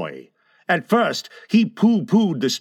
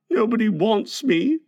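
put-on higher, mildly tearful little boy; a touch of near-tears, pitched up; nearly crying; 6 semitones higher than usual. A narrator's reading. Small boy feeling unwanted by the family, nearly crying. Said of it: "Michael had nearly cried".

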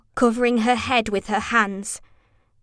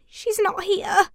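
high, slow, tense murmur; strained, raised pitch; tight voice; 11 semitones higher than usual. A narrator's reading. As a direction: say it tight with worry. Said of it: "Her voice was tight".

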